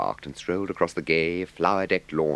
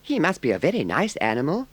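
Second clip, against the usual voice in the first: high, timid little mole voice; a much higher, eager little animal; 11 semitones higher, 3 dB louder than usual. A narrator's reading. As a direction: ask shyly in a small high voice. Mole's line.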